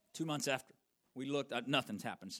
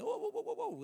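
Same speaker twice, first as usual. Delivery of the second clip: a run of sharp whoas jumping very high; shocked whoa. Retold or imitated. Pastor hearing the rent price, retelling his startled whoa. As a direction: react to the price in comic alarm. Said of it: "I'm like".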